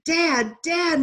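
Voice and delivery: high, loud desperate call for his father; crying out. Acted. Boy in the sea calls for his father, loud desperate cry. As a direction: shout after the vanishing father. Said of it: "Mahmoud cried".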